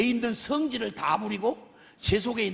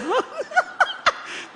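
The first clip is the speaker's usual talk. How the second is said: high-pitched laugh; 3 dB louder than usual. Real, not acted. Preacher marvelling at God quarrelling with Jonah, laughing high. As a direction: burst of high, incredulous laughter. Preacher cracks up mid-story.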